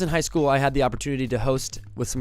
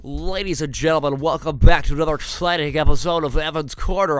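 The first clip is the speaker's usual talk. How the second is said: silly host voice; 6 semitones higher than usual. Pretend. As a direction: ham up a fake talk show host. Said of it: "I'm gonna talk like this the entire time".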